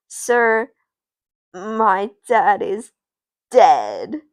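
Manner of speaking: loud, slow dramatic reveal; grave announcement. Acted. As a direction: deliver the terrible news with heavy pauses.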